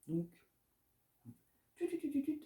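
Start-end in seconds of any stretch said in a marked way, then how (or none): 1.8-2.4 high mock rewind beeps; playful rewind noise; rewind sound. Shown as a demonstration. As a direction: imitate a tape rewinding. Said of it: "l'effet rembobinage".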